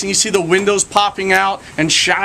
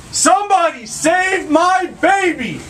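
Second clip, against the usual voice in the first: high, slow, drawn-out cry for help; desperate yell; 13 semitones higher, slower than usual. Acted. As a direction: call down from the top floor, stretching it out.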